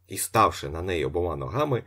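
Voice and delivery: usual speaking voice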